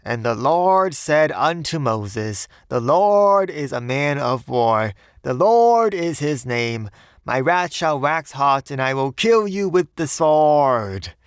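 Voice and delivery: loud, high, drawn-out pulpit bellow; bellowing preacher. A narrator's reading. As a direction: thunder scripture slowly with long-held words. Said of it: "but when he bellowed".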